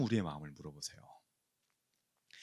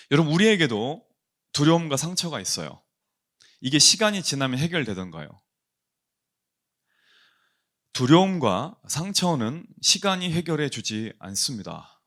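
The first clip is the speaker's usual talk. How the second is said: rising, louder preacher's appeal; preaching; 4 semitones higher, 6 dB louder than usual. Acted. Pastor urging the congregation about fear, fervent preaching. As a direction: press the point home to the pews.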